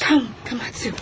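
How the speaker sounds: hushed urgent whisper. Acted. Lady urging her friend to leave, urgent whisper. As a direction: whisper her away from him.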